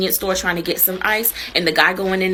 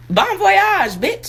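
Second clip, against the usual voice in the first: higher laughing sign-off; 8 semitones higher, 3 dB louder, much slower than usual. Real, not acted. Saying bon voyage to the viewer, laughing and higher. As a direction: cackle the farewell out.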